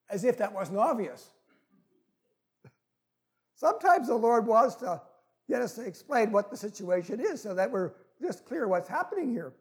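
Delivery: ordinary talk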